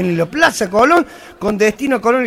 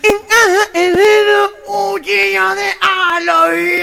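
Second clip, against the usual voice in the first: refrán nasal, agudo y alargado; presidente gangoso; 7 semitones higher than usual. Acted. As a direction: lanza el dicho como gangoso imitado. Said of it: "voy a decir un dicho". (orador gangoso proclamando un dicho)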